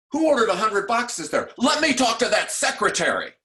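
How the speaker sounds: high, loud demanding bark; angry superintendent. Pretend. Imagined superintendent yelling down the phone, high and loud. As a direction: demand to know who did it, loudly.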